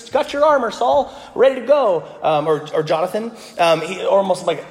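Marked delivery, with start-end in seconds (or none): 0.1-2.1 high-pitched armor-bearing sidekick; eager lackey. Acted. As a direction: pipe up like an obliging patsy. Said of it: "like, a shield-carrying lackey, just like a patsy".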